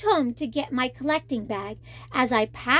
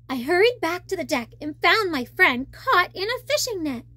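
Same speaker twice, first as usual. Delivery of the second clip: ordinary delivery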